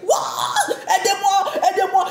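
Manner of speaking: high panicked calls for help; frantic high-pitched shouting; retold cry for help. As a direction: scream for help, terrified. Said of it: "J'ai commencé à crier".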